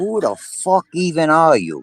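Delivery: slow, laughing Brooklyn put-down; hammed-up Brooklyn challenge; Brooklyn accent on request. Shown as a demonstration. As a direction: lay on the Brooklyn accent, drawn out. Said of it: "have him say".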